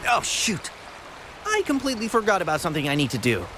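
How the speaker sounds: high, fast and flustered; sudden realization. Acted. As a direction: blurt it out and rush off. Suddenly remembers, hurries off.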